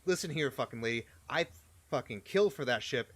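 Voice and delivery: loud, fast angry snarl at the lady; pissed off. Acted. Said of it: "looks very pissed off".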